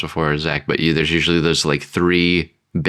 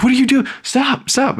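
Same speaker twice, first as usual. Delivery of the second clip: frantic shriek at the genie; mock high-pitched panic; panicked shriek. Worker yelling at the messy genie.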